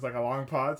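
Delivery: ordinary delivery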